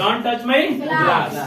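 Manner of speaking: slow model sentence. Shown as a demonstration. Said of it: "teacher models sentence".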